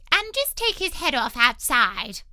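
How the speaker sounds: loud, high imperious queen; a bossy queen giving orders; Queen's voice. A narrator's reading. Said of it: "the Queen added".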